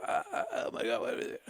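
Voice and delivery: high, breathless, pained cry; gasping out the pain he relives. Retold or imitated. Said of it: "I'm racked with pain".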